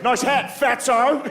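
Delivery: high, drawn-out Australian jeer; mocking Australian accent. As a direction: toss the insult in a slow, high Australian accent. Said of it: "Australian accent's one of those accents".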